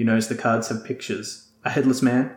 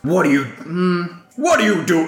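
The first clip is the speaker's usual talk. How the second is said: booming deep voice; 6 semitones higher, 4 dB louder than usual. Acted. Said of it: "His deep voice booms".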